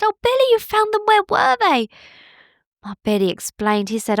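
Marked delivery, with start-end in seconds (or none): 0.0-1.9 very high, fast and thrilled; excited bird. Acted. Bowerbird thanking Billy, high fast and excited. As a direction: tumble the words out with joy. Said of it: "he was all excited".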